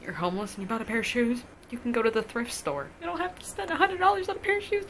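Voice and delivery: incredulous scolding. Retold or imitated. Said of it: "scolding him over the shoes".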